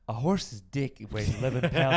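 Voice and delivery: laughing through a mock narrator line. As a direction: giggle out the line. Mock documentary narrator quote.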